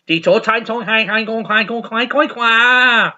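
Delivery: high mock foreign babble; hammed-up mimicked scoreboard announcer; foreign announcer gibberish. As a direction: rattle off nonsense syllables, drawing out the last. Mimics foreign judges announcing points.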